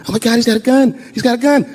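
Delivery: high mock cries of a crowd; retold panicked screaming. Onlookers screaming about an attacker onstage, mock panic. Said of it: "Everybody started screaming".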